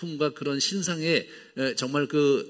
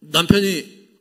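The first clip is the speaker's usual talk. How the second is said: stern reply; 6 semitones higher, 8 dB louder, slower than usual. Retold or imitated. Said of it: "이렇게 엄격하게 답을 했는데".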